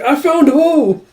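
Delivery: high, slow squeal through a laugh; high, slow laughing cry; delighted squeal. Acted.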